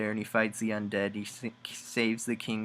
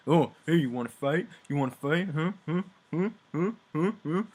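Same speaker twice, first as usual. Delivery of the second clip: higher, louder goading voice; mock taunting; 6 semitones higher, 4 dB louder, faster than usual. [name] goading Saskia into a fight, mock taunting. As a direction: needle her like a schoolyard bully.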